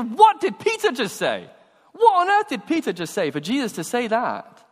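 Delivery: high, loud, astonished question; shocked disbelief. Acted. Disciple hearing Jesus rebuke Peter, shocked. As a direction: exclaim as a stunned onlooker.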